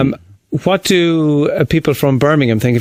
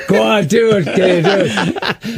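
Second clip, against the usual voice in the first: pleading nag; 4 semitones higher than usual. Retold or imitated. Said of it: "I plague him".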